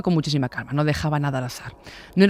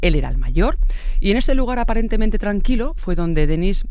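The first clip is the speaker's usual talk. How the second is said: ordinary talk, nothing marked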